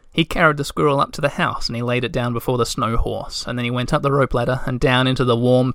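ordinary delivery

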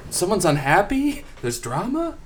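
high, squeaky mock disbelief; mock surprise. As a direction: act dumbfounded that there could be drama. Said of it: "and he's like".

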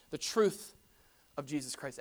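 ordinary delivery

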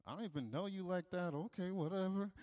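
very soft, reluctant mutter; grumbling aside. Retold or imitated. Child sent outside by a stranger, grudging mutter. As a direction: mumble it under your breath.

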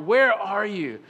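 loud, slow, pleading call to God; crying out. Shown as a demonstration. Pastor shows the church how to cry out to God, loud and slow. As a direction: stretch out a desperate cry to God. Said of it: "He can handle you crying out".

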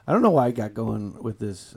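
usual speaking voice

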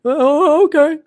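high, limp-wristed millennial voice; mock an effete millennial; millennial impersonation. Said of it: "my li-, limp-wristed millennial impersonation".

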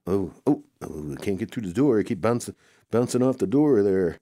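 frantic yelps. Acted. Stuck bouncing against the door.

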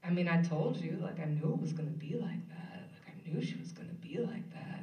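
imitated solemn student, dropping to a whisper. Student solemnly telling teacher he told him so, voice sinking to whisper. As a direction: solemnly let it fade to a near-whisper. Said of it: "you know, very solemnly".

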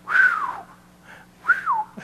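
a long, very high awed whistle. Real, not acted. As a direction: whistle at how big the number is. Preacher awed by the number.

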